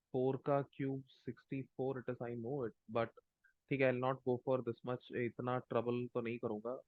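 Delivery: very faint, distant microphone; barely audible. Real, not acted. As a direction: speak far too softly into a bad mic. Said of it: "your voice is very low".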